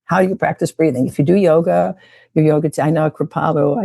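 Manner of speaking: usual speaking voice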